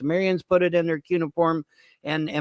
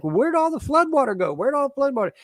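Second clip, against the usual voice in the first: higher, mock-whiny doubter voice; mock skeptic; 9 semitones higher than usual. Host mocking the flood-water doubters, higher mock-whiny voice. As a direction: parrot the doubters in a higher sing-song. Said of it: "everybody's like".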